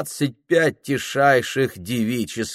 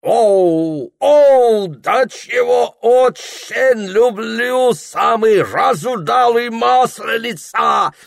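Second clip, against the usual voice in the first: put-on high, loud exclaiming with an English accent; 12 semitones higher, 6 dB louder, slower than usual. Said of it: "восклицает мистер Кук".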